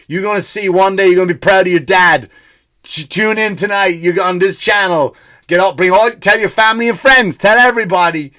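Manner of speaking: rally the family like a thrilled dad; acted proud excitement.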